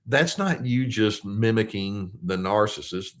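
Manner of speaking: ordinary talk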